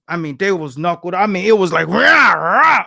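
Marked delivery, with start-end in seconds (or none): 1.9-2.8 two short, loud grunted rahs; imitated sparring grunts. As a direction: mimic the boxers grunting as they punch. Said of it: "all you could hear the grunts".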